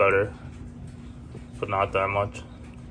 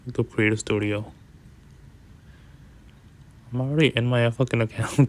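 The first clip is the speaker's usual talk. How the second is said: ordinary talk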